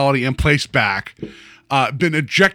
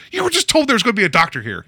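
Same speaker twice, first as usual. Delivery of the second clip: high, quick mock disbelief; mock exasperation; 8 semitones higher, faster than usual. Host answering the townsmen's doctor question, mock exasperation. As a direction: rattle it off in playful exasperation.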